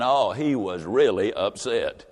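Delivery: drawn-out "Oh, he was really upset"; low and slow, let it land; deep, unhurried refrain. A narrator's reading.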